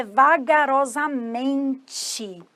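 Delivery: stretched, slow-motion "vagarosamente". Shown as a demonstration. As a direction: drag out "vagarosamente" syllable by syllable. Demonstrates the word for slowly.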